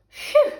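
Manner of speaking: high, loud sigh of relief; relieved phew. Acted. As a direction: let out a big relieved phew. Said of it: "said the dog".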